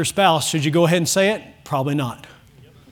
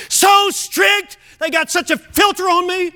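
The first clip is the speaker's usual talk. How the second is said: higher, exaggerated complaint; mock teen outburst; 9 semitones higher, slower than usual. Preacher mimicking a teen griping to the church, hammed-up. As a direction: whine it like a fed-up teen.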